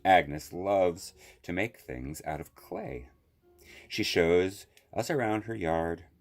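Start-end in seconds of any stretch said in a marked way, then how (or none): none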